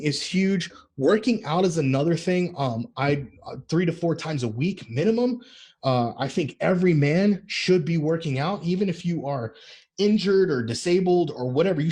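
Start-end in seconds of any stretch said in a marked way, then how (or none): none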